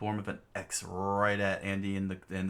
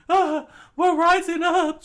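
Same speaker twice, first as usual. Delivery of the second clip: shrill-high frightened yelp, much louder; high panicked scream; 13 dB louder than usual. Acted. Chikara screaming as he is launched into the air, panicked high yell. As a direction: scream in panic as the ground drops away. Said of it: "Chikara screamed out".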